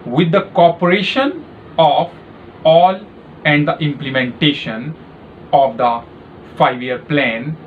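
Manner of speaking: slow, clearly spaced dictation voice; dictating a passage for a shorthand test; slow dictation read. Shown as a demonstration. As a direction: read each phrase out slowly for typing practice. Dictation reading to typists.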